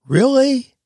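REALLY pitched high and rising, a doubting question. Shown as a demonstration. Host doubts the saying, REALLY as a high questioning rise. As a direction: ask "Really?" high, as if unconvinced. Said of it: "changing how you emphasize the last part of it".

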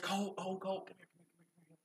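fading to a whisper; calling softly. Retold or imitated. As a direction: coax the boy over, dropping to a whisper.

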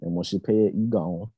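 slow, soft, cold terms; cold dismissal. Retold or imitated. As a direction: calmly tell her to pay and leave. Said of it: "I'm gonna say".

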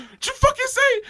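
very high-pitched mock outrage; hammed-up indignant challenge; outraged comeback. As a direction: fire back in incredulous high-pitched anger. Repeats friend's outraged comeback.

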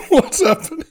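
high laughing echo; laughing squeal. Retold or imitated. Mocking the quote, laughing.

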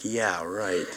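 low, soft, sarcastic yeah right with a laugh; sarcastic disbelief. Retold or imitated. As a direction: scoff at the parent's line, quietly. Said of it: "I'm like".

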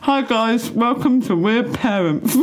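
talking through a mouthful; laughing with mouth full of sausage. Real, not acted. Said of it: "speaking with my mouth full".

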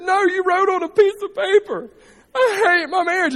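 high, loud mock accusing wife; wife accusing husband, hammed-up and high. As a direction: throw it back at him tearfully.

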